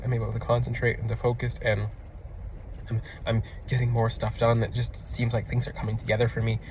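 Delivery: soft, even and settled; calm focused voice. Retold or imitated. Grounded client reporting his progress, soft and calm. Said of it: "And he's just talking just like that".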